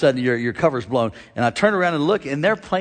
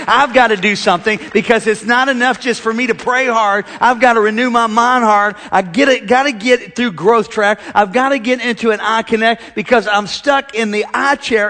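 raised, driving preacher voice; preaching; 6 semitones higher, 6 dB louder than usual. Acted. Pastor urging the congregation, preaching hard. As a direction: drive it home to the congregation.

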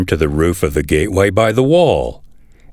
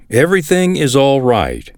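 mild high-pitched cry of news; 7 semitones higher than usual. A narrator's reading. Ahimaaz brings news to the king, crying out. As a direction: call it out with pitch lifted, little extra volume. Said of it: "Ahimaaz cried out to the king".

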